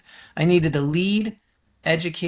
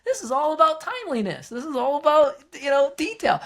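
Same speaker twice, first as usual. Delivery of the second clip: excited realization; 11 semitones higher than usual. Retold or imitated. Emphatic realization, very high pitch.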